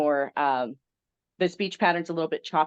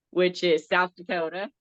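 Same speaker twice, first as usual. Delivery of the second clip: usual speaking voice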